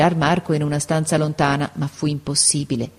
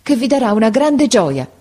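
raised pitch, mild agitation; held-back excitement, pitched higher; growing agitation; 6 semitones higher, 4 dB louder than usual. A narrator's reading. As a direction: build up to big news, a little agitated. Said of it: "sempre più agitata".

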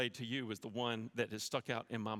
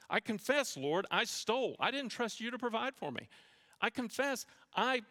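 impassioned confession prayer; 6 semitones higher, 3 dB louder than usual. Acted. Fervent model confession prayer.

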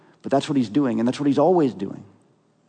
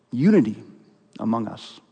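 usual speaking voice

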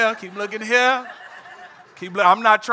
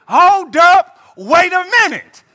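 mock surprise; 7 semitones higher, 4 dB louder than usual. Preacher reacts as himself, mock astonished.

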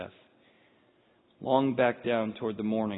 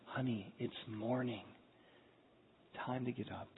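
incredible tenderness; 14 dB quieter than usual. Acted. Jesus waking the little girl, very soft tenderness. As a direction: wake a sleeping child very softly. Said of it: "in incredible tenderness".

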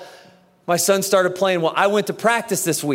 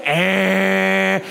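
annoying nasal noise. Retold or imitated. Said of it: "I don't know what he's doing".

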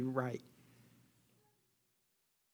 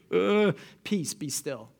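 sleepy yawning Jesus; slower than usual. Acted. Jesus just woken by the panicked disciples, sleepy murmur. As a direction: yawn it out like someone just woken. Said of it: "I can imagine he kinda yawns".

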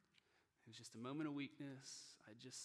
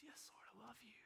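very soft, higher whispered reply; whispered devotion; 7 semitones higher, 14 dB quieter than usual. Acted. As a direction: whisper it as a humble pledge. Peter's reply to Jesus, acted.

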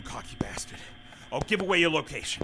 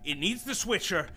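normal talking voice

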